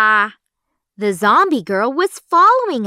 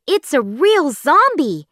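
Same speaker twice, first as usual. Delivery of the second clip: put-on higher, louder, scared boys; frightened cry; 6 semitones higher, 4 dB louder than usual. A narrator's reading. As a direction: panic at the sight of the zombie. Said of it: "they said".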